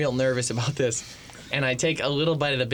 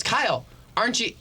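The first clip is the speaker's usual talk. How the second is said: raised-pitch imitation of a friend singling someone out; retold calling out; 7 semitones higher than usual. Friend calls out the egg roll dodger, mock call-out. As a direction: lightly mimic a nosy call-out.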